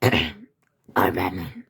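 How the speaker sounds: low, slow, rasping growl; dark, husky superhero voice; Batman. Acted. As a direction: growl it slowly from deep in the throat. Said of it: "I'm trying his Batman voice".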